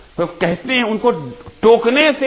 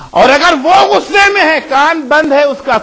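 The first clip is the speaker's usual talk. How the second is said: fervent preaching; 8 semitones higher, 7 dB louder than usual. Real, not acted. Preacher urging parents to wait for the right moment, fervent raised voice. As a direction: drive the point home to the congregation.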